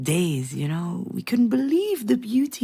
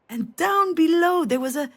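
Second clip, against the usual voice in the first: ordinary talk, nothing marked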